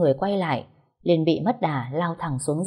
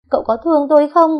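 much higher and louder fast sobbing question; crying plea; 10 semitones higher, 9 dB louder, faster than usual. A narrator's reading. Liên crying again as she asks her husband if he loves her, sobbing. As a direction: wail the question at him. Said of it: "lại khóc um tỏi lên".

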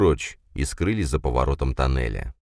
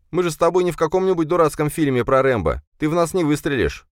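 Ben's raised voice; 11 semitones higher than usual. A narrator's reading. Ben argues with the major.

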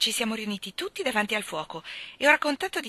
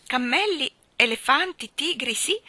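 amazed listing; 5 semitones higher, 6 dB louder than usual. Acted. Martha lists India's marvels, amazed.

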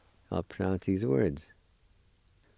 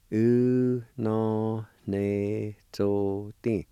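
U no ne zo ti drawn out, each syllable held; very slow; much slower than usual. Shown as a demonstration. Teacher showing students how to break a word down. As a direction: slowly break U no ne zo ti down. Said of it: "Just to give you an example".

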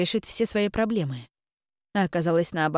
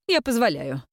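stately; 3 semitones higher than usual. A narrator's reading. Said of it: "величественно произнесла она".

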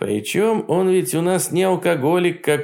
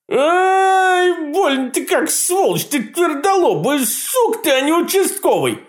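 визгливый высокий вопль боли; взвизгни, будто отбил пальцы; Кощей от боли; 4 dB louder than usual. Acted. Кощей ударился о печь. (Кощей ругается от боли, высоко и громко)

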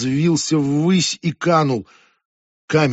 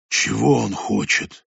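suddenly hoarse; 3 semitones lower, slower than usual. Acted. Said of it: "внезапно охрипшим голосом".

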